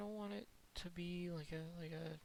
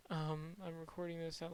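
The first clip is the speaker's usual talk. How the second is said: ordinary talk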